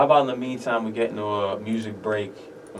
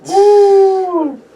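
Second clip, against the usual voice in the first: high, loud hype cheer; excited woo; 13 dB louder than usual. Real, not acted. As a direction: whoop it up after the verse. Hype shout after the verse.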